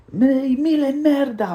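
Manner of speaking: high loud mock Italian curse. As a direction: spit it out in fake angry Italian. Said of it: "whatever ikea Italian bullshit he said".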